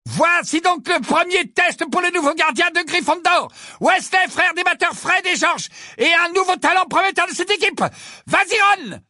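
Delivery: high, ringing sports announcer; excited commentary. A narrator's reading. Lee introducing the new Gryffindor keeper, excited and high. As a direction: build to a cheer for the keeper.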